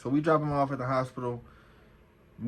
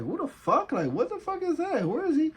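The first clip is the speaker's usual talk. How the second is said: high-pitched, incredulous questioning; retold bewildered outburst, pitched way up; baffled disbelief. Officer to his partner about someone approaching, baffled disbelief. Spotting an unexpected uniform, baffled.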